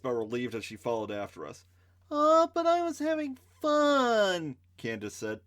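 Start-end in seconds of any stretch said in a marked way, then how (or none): 2.1-4.6 high, slow, whiny letdown; disappointed. Acted. Candace complaining about leaving the fight, disappointed whine. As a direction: pout it out, sorry the fun ended.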